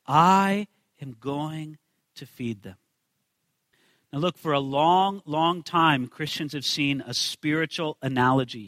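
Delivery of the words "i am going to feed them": slow, low, measured declaration; Jesus speaking. Acted. Jesus announces his plan to the disciples, slow and deliberate. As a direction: say it slowly with deliberate weight, low. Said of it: "and Jesus says".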